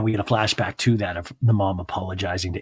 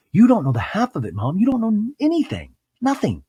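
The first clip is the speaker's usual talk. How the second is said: high-pitched mock exasperation, climbing higher; indignant outburst; 10 semitones higher, 4 dB louder than usual. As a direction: imitate an indignant daughter scolding her mom. Acted quarrel with mom.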